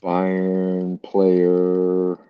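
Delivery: usual speaking voice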